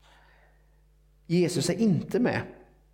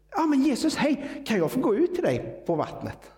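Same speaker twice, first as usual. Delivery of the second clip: overwhelmed Peter; 10 semitones higher than usual. Retold or imitated. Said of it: "så är han också överväldigad".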